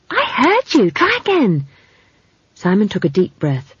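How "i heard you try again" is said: high-pitched, excited urging; insistent girl. Acted. Esk urging Simon to try again, insistent. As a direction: insist and egg him on.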